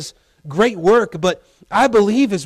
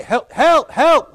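repeated high shouts for help; acted cries of a drowning swimmer; calling for help; 7 semitones higher, 3 dB louder, slower than usual. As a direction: call out again and again, louder.

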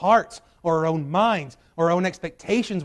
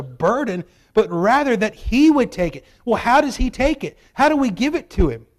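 louder, higher, driving sermon climax; preaching at full force; 6 semitones higher, 8 dB louder than usual. Acted. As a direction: push the message home, full preacher force.

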